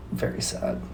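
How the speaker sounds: a fake grieving sigh of a whisper; mock sad whisper. Repeats the joke lament.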